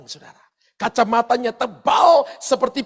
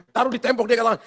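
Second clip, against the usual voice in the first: normal talking voice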